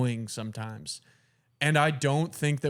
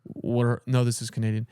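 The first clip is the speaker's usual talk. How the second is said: normal talking voice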